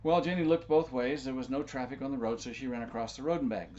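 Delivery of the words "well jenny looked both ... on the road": ordinary delivery, nothing marked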